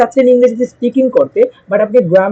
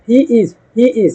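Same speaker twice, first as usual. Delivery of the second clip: very slow, clear drill repetition; much slower than usual. Shown as a demonstration. Teacher to students, drilling he is slowly to copy. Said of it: "আমি যেভাবে বলতেছি ওইভাবে practice করেন".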